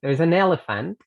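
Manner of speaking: "There's an elephant" run together; linked. Shown as a demonstration. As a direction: glide the s and n into the vowels. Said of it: "los voy a juntar".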